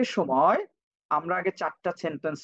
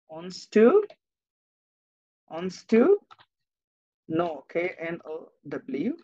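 slow dictation; 2 semitones lower, much slower than usual. Shown as a demonstration. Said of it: "teacher dictating spelling".

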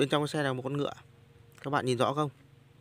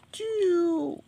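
high squeaky toy noise. Pretend. Toy digger sound effect.

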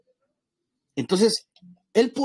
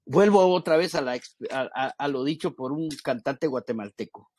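normal talking voice

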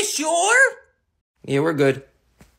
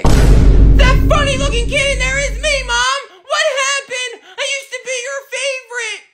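mock wailing; 3 semitones higher, 9 dB louder than usual. Billy wails about not being favorite.

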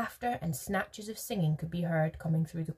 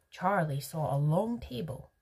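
ordinary delivery, nothing marked

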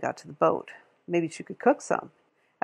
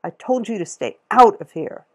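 louder, harsh scolding voice; stern store lady; 3 semitones higher, 7 dB louder than usual. A narrator's reading. Store lady scolds the girl she caught, loud and stern. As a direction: tell the kid off hard.

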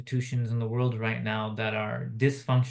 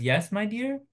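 rising, puzzled "Yes, my dear?"; questioning; 9 semitones higher than usual. Retold or imitated. Operator unsure what the child said, questioning. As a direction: ask it back like you didn't catch it. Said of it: "kind of questioning".

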